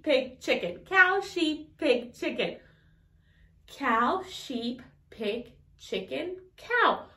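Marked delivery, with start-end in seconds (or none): none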